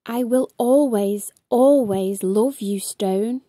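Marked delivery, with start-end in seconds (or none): none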